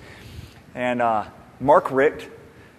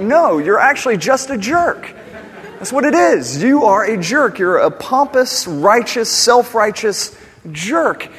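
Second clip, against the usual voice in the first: raised, higher, louder telling-off; retold blunt scolding; 9 semitones higher, 8 dB louder than usual. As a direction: tell him straight he's a jerk, loud. Preacher retells scolding the jerk.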